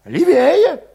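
put-on high, tense young Cossack voice; high-voiced young troublemaker; Valet's voice. A narrator's reading. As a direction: pitch him up high and edgy.

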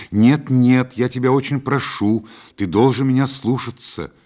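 high, loud maternal insistence; insistent pleading. A narrator's reading. Mother insisting her son take the bed, urgent.

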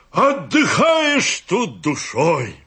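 high, ringing, hammed-up raptures; over-the-top praise, pitched very high; grand proclamation. A narrator's reading. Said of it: "провозгласил пятый".

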